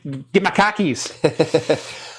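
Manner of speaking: put-on slow, drawn-out Boston accent. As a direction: stretch it in a thick Boston accent. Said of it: "where the Boston accent came from".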